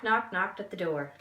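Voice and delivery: plain reading voice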